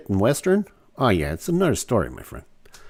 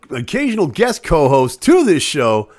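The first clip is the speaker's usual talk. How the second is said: higher, louder hype-man welcome; announcer intro; 4 semitones higher, 5 dB louder than usual. Acted. Host welcoming his guest co-host to the chat, announcer hype. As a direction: announce him like a ringside MC.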